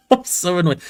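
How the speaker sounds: amused at your own annoyance, laughing it out. Real, not acted. Football fan laughing at her team's loss to viewers.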